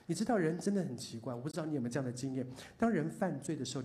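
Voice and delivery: normal talking voice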